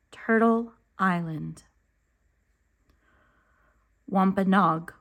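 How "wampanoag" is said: plain reading voice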